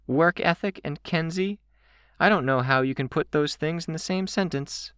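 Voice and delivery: high-pitched teasing voice; teasing girl character. A narrator's reading. Candy ribbing Kenzie about work ethic, teasing high voice.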